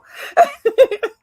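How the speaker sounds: high laugh through tears; loud high choked laugh; tearful laugh. Real, not acted. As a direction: let a teary laugh break out. Laughing after emotional quote.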